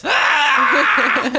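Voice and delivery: high, loud answering scream; boar yell back. Acted.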